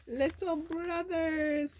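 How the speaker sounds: high, slow, joyful Olaf; happy cry. Acted. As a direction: cry it out with delight. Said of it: "he cried happily".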